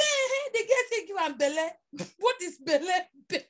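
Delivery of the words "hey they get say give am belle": high, loud cry of disbelief; mock outraged exclamation. Retelling the family's pregnancy row, mock outrage. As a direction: blurt it out as a scandalised relative. Acted shocked reaction to pregnancy claim.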